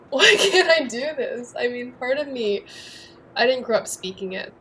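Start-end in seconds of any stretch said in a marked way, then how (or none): 0.1-1.5 high, loud, slow cry of frustration; half-laughing self-exasperation; exasperated outburst. Retold or imitated. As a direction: throw up your hands at yourself. Retelling frustration in Mandarin class.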